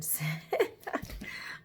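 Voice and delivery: soft, breathy little laugh; embarrassed chuckle. Real, not acted. Reader amused by own bad accent, small laugh. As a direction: chuckle at your own slip.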